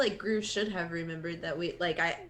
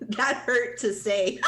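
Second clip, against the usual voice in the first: loud laugh through the words; 9 dB louder than usual. Real, not acted. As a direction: crack up while saying it. Player laughs at own line.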